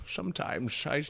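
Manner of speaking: Sean Connery impression. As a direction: purr it like Connery. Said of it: "I can do Sean Connery pretty well".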